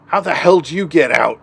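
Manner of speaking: acted startled demand. Game master voices cultist seeing escaped prisoner, startled demand. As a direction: blurt it out as a startled guard.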